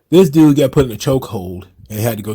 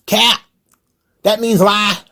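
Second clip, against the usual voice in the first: higher, lightly voiced young slang; a hint of teen slang, higher; mock youthful slang; 9 semitones higher, slower than usual. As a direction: imitate him talking like his son, higher. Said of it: "he always talking about".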